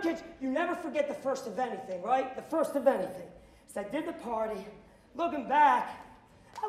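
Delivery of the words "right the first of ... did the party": usual speaking voice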